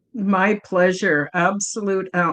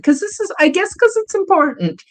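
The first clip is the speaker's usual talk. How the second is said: emotional; 9 semitones higher, 3 dB louder than usual. Real, not acted. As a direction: voice lifting high with real feeling. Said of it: "I just get emotional".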